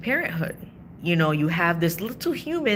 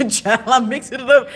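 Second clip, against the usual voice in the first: higher, louder, laughing at herself; laughing exclamation; 6 semitones higher, 8 dB louder than usual. Real, not acted. Laughing to viewers at her mangled saying, loud. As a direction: burst out laughing at your own slip.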